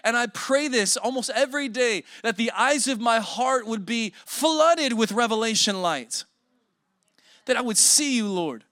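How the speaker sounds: loud, rising preacher's prayer; fervent preaching. Acted. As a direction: pray it out with full force. Preaching prayer at full force.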